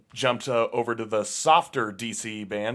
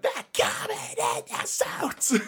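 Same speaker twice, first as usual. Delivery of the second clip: airy high mock singer voice; whisper-squeal like a punk frontman; imitating the singer; 3 dB quieter than usual. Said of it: "Or like".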